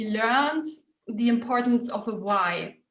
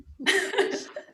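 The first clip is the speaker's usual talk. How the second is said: high laugh; 9 semitones higher than usual. Real, not acted. Laugh at money question.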